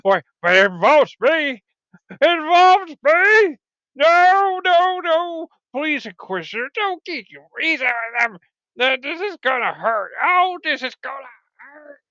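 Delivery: high, hammed-up pleading; mock dread. Victim begging the Inquisitor before it hurts, mock dread. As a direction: whimper theatrically like a doomed victim.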